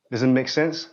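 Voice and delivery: ordinary talk, nothing marked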